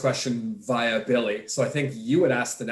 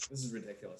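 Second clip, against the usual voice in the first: quiet mutter; 5 semitones lower, 16 dB quieter, faster than usual. Real, not acted. Mouth burning, muttering to himself.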